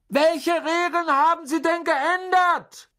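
very high, slow, loud yell; shouting. A narrator's reading. Boys calling after Misses Weasley from the train, shouting. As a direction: call desperately after someone as the train leaves.

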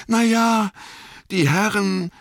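high, loud and sheepish hesitation; awkward, fumbling start; a bit embarrassed. Acted. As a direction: gardener caught out, a little embarrassed. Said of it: "sagte Sam ein bisschen verlegen".

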